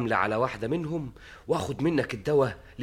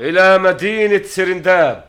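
slow loud royal order; royal command; 8 semitones higher, 13 dB louder, slower than usual. Acted. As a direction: proclaim the destination. King commands departure.

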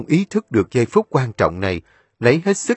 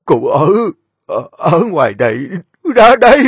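halting, high cries from a wounded man; wounded old man calling out in pieces; broken calls; 7 semitones higher, 7 dB louder than usual. A narrator's reading. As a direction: gasp out each fragment. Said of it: "tiếng gọi ngắt quãng của cụ".

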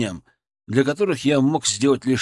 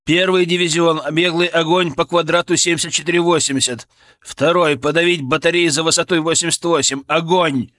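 barked command; 4 semitones higher, 4 dB louder than usual. Acted. Artillery fire command.

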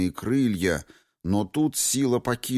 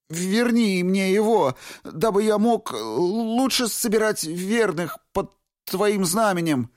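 desperate shout; 12 semitones higher than usual. A narrator's reading. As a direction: keep shouting, forcing out every word.

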